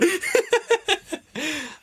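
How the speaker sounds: high burst of laughter. Real, not acted. Host laughing at the scene transition, high laugh. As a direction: laugh at the cut.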